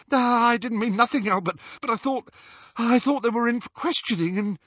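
high nervous grovelling voice. A narrator's reading. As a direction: stammer an excuse in fear. Balding wizard grovelling.

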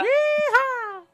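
high, loud cowboy yeehaw whoop; fake cowboy accent. Reacting to living in the South.

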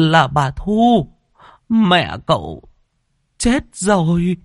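the servant whimpers the death news to his master, tearful; whimpering servant. Acted. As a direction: plead with a quivering, near-crying voice. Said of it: "Thằng Tý mếu máo nhìn".

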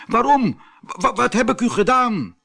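high, stammering plea; very frightened. Acted. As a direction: cry out and stumble over the words in fear. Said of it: "Abdullah werd heel erg bang". (heel bang, stamelend)

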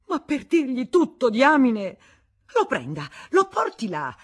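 loud, high-pitched impatient command; exasperated urging. A narrator's reading. Woman urging reluctant Federico upstairs, exasperated. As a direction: push him to act at once.

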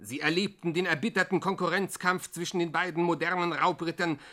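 ordinary delivery

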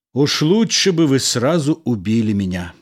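plain reading voice

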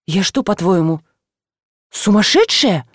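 offended rhetorical question; a touch of affronted disbelief; indignant. A narrator's reading. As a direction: protest with mild indignation. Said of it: "возмутилась Галя".

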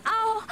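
sharp high yelp of pain; high startled ow; pained cry. Acted. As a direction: cry out as she's grabbed. Pulled by husband, cries out.

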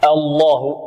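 الله with a broad a and a heavy L; correct Allah. Shown as a demonstration. Teacher giving students the correct form. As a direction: say الله open and full-mouthed. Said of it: "نعم، وليس الله".

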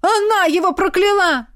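high, furious shriek; roaring accusation. A narrator's reading. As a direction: scream the accusation at the crowd. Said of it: "Рогнеда тотчас взревела".

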